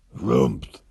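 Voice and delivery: upset dragon harrumph; dragon grumble. Acted. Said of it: "said the dragon".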